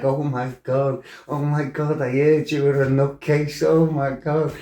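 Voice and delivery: put-on high, shocked, breathless girl voice; imitating her horrified squeal; mock panicked girlfriend. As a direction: squeal it like a scared date. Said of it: "she's like".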